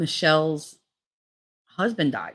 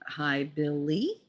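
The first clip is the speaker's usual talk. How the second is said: slow, drawn-out mock-babying BILLY, softer; pointed corrected greeting; 7 dB quieter, much slower than usual. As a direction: stretch out Billy with exaggerated care. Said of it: "I figured you were older".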